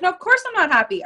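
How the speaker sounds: mock offended client; indignant retort. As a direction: bristle back at the question. Client answers coach indignantly.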